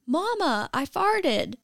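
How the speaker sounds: put-on high child calling out across a store; kid yelling. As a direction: announce it proudly to the whole store. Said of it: "my son yells across the crowded store".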